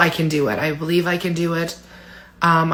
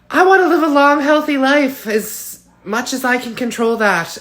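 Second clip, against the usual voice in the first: emotional; 6 semitones higher, 4 dB louder than usual. Real, not acted. Emotional wish for long life.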